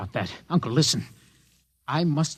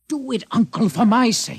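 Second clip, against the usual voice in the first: urgent plea; 8 semitones higher, 6 dB louder than usual. Acted. Begging uncle urgently.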